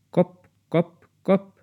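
ordinary delivery

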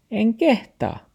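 high, slow dog voice. Acted. The dog shyly refuses grandpa, high. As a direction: whine it high.

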